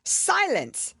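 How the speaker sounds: high, loud shout of silence; cackled out. A narrator's reading. King cackling for silence in court. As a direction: bark for order. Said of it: "cackled out".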